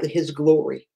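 ordinary delivery

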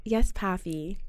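put-on low murmur to a sugar daddy; cringy sultry demo. Shown as a demonstration. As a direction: demonstrate it low and soft, as a pet name. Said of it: "You gotta say it like this".

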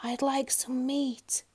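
slow, high, breathy whisper; tiny hushed request; timid whisper. A narrator's reading. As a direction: whisper it slowly and meekly. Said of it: "he whispered".

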